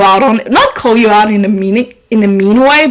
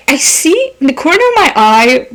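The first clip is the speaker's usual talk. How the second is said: ordinary delivery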